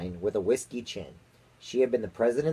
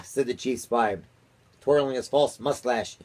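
plain reading voice

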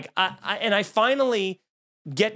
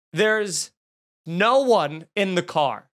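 drawn-out words with a pause after the first; slow dramatic reveal; much slower than usual. Retold or imitated. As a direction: let the reveal land slowly. Slow reveal in his story.

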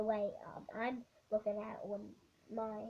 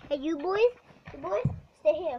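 calling out; 5 semitones higher, 11 dB louder than usual. Real, not acted. Kid telling the boys to stay, calling out. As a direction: call them to attention, loudly.

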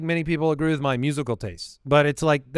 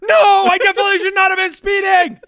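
very high, sustained, loud yell; top-of-lungs shout; 7 dB louder than usual. Shown as a demonstration. Driver answering police officer about speeding, shouted. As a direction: scream out the confession at full volume. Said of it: "shout your answer at the top of your lungs".

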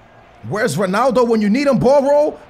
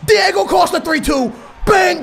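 goal shout; 8 semitones higher than usual. Real, not acted. Go-ahead goal.

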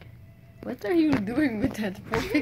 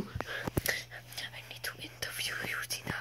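whisper; 12 dB quieter than usual. Pretend. Same words repeated in a whisper.

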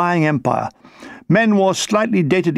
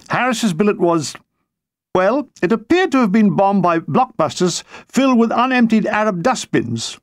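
plain reading voice

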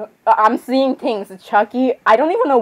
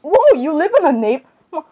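high voice breaking up mid-word; high, cracking outburst that falls apart; 6 semitones higher, much slower than usual. Real, not acted. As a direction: let the voice crack as it climbs. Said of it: "my voice is cracking".

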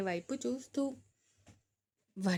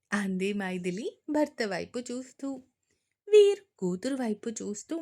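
ordinary delivery

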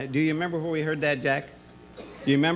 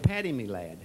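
a light Irish lilt on "Paddy, me lad", a bit lower; Irish accent; 4 semitones lower, 3 dB quieter than usual. Retold or imitated. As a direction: greet him as a jolly Irish boss, lightly Irish. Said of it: "I do a good Irishman".

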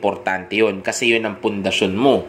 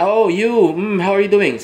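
much higher, louder delighted voice; excited woman; 10 semitones higher, 5 dB louder than usual. Acted. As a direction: squeal with recognition. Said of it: "maalala niya". (masayang boses ng babae)

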